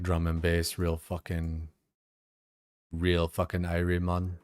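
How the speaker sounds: normal talking voice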